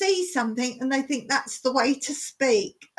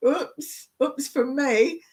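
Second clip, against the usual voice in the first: usual speaking voice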